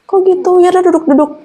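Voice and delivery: high-pitched, taken-aback mother; concerned surprise. Retold or imitated. Mother hears of strange crying and seats them, concerned surprise.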